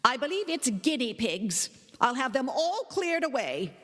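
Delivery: lightly voiced stern aunt; a hint of a dismissive guardian; aunt dismissing the pets. A narrator's reading.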